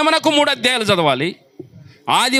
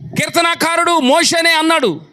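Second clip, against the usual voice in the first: high, driving sermon voice; impassioned pulpit delivery; fiery preaching; 6 semitones higher than usual. Acted. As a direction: rouse the congregation. Preaching at full force.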